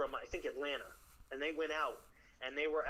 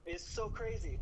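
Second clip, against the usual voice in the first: ordinary talk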